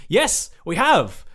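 loud, slow, eager answer; excited. Real, not acted. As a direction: exclaim it gladly. Answering the letter.

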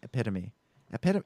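"Epitome" as uh-PIT-uh-mee, trying it out; correct epitome, tested. Shown as a demonstration. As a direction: repeat "Epitome" with stress on PIT. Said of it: "maybe we're the ones saying it wrong".

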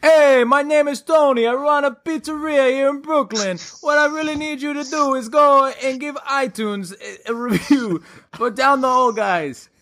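hammed-up Brooklyn pizzeria owner pitch; New York accent.

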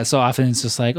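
ordinary talk

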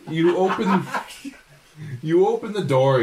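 laughing while repeating it; giggling through the words; amused. Real, not acted.

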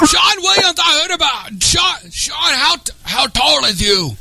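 high, drawn-out caricature voice; high impression with broken grammar; Dennis. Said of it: "So go do Dennis".

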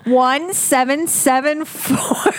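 slow, deliberate number reading. Shown as a demonstration. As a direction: spell it out slowly for the listeners. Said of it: "Just slow, slow down just a little".

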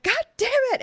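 very high, loud mock yell; exasperated yell. Imitating Pappy yelling after his butt is grabbed. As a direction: squawk it like you've been grabbed again. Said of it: "And he's like".

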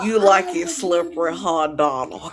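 a lightly voiced creepy seducer; creepy seductive voice.

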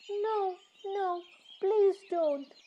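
high, pleading little mouse; frightened mouse. Acted. Mouse begs the lion not to eat him, frightened. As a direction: beg in a tiny high voice. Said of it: "the little mouse cried".

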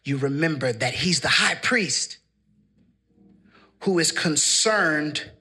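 low, softened, measured sermon close; hushed preaching. Real, not acted. Preacher comforting the heartbroken, hushed preaching.

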